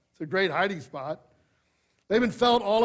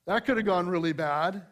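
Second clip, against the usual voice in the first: ordinary talk, nothing marked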